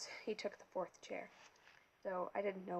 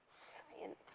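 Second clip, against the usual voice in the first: breathy dismissive scoff; 12 dB quieter than usual. A narrator's reading. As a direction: sneer the word away. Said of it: "Mister D scoffed".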